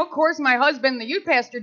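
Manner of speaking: ordinary delivery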